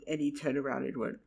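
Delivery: ordinary talk, nothing marked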